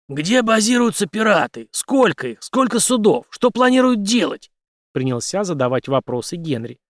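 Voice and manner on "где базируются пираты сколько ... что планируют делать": high, punchy questioning; demanding interrogation. A narrator's reading. Henry questioning the major about pirates, demanding. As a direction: fire questions at the prisoner.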